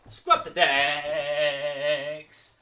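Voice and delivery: very slow, loud drawn-out call; called command. Shown as a demonstration. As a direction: drag each word like a long scrub. Game command.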